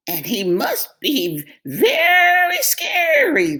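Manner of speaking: very high, slow trembling sheep; scared sheep. A narrator's reading. Sheep imagines a scary Storm. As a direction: draw it out like a frightened sheep.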